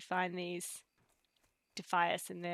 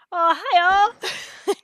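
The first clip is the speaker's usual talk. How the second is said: much higher, loud burst of hello; light up at seeing the chat; delighted shout to viewers; excited greeting; 11 semitones higher, 15 dB louder than usual. Real, not acted. Suddenly notices chat comments.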